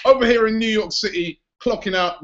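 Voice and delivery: a hint of a New Yorker's twang, in a New York accent. Presenter signing off in a mock New York accent. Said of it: "He's even got me doing the accent".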